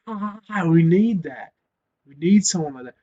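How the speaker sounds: mock lunatic scream. A lunatic player running out, mock screaming. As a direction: play the raving lunatic hyping the crowd. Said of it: "runs out there screaming like".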